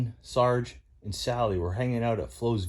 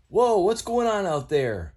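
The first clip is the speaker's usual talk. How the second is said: startled Mater; 8 semitones higher, 3 dB louder than usual. Acted. Mater woken.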